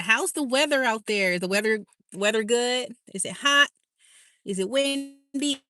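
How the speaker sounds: ordinary talk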